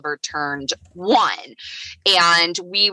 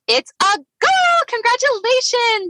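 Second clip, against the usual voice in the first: very high, celebratory counselor voice; imitated congratulatory squeal; gleeful announcement. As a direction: break the happy news in a high lilt. Counselor announces baby's gender.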